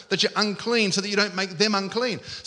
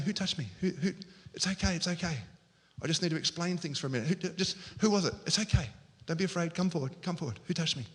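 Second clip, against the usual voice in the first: soft, tender coaxing; softly ask, putting the frightened at ease; gentle reassurance; 3 semitones lower, 9 dB quieter, faster than usual. Shown as a demonstration. Jesus inviting the afraid woman to come forward, gentle reassurance. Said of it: "I reckon he was saying".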